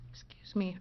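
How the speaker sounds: ordinary talk, nothing marked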